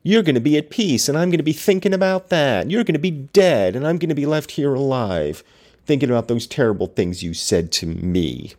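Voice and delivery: mocking Heathcliff. Host mocking Heathcliff's self-pity to listeners, high whiny parody. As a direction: whine it like a self-pitying lover. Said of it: "and he's like".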